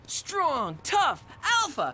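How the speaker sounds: building pep talk. Pretend. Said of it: "Just go over there and be aggressive".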